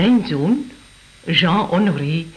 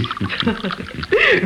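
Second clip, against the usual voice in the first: laugh; 8 semitones higher than usual. Acted. Amused laugh at toast.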